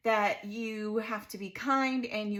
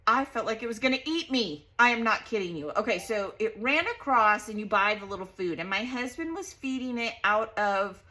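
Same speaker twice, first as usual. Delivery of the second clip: ordinary talk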